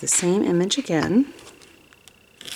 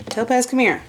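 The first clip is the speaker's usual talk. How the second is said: calling the cat; 7 semitones higher, 7 dB louder than usual. Real, not acted.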